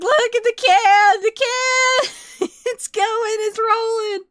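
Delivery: put-on high, loud childish excitement; excited kid shouting. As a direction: shriek like a kid watching a can roll. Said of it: "I was, I was like".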